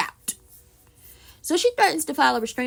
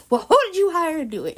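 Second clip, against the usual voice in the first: louder, higher demanding question; loud accusation; 6 semitones higher, 6 dB louder than usual. Retold or imitated. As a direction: press him hard. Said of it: "she was like".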